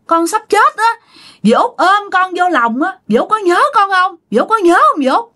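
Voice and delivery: high, plaintive nephew's voice; spirit pleading with aunt. Retold or imitated. Dead nephew asks his aunt to remember him, high pleading voice. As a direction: plead tenderly, pitched far above normal. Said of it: "Nhìn qua bên mẹ của bạn nói rằng á là".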